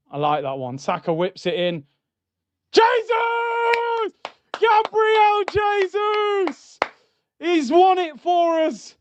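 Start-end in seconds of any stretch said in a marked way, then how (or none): 2.7-8.9 real fan going wild at a late winner; ecstatic goal scream. Real, not acted. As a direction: scream the name high, drawing it out long. Late winner goal celebrated live.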